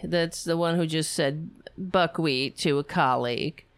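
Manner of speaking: ordinary talk, nothing marked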